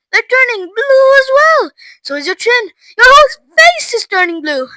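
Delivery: high, loud alarm; horrified scream. A narrator's reading. Mother watching Violet's face change, screaming. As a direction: cry out each new horror. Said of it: "screamed Missus Beauregard".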